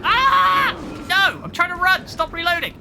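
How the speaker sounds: high, loud frantic yelling; panicked shout. Real, not acted. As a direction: scream at the game in panic. Monster attacks while reloading.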